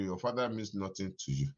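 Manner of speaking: ordinary talk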